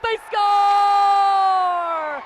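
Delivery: drawn-out high shout of they score; long goal yell. Real, not acted. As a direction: hold the word score long and loud. Goal call, long held yell.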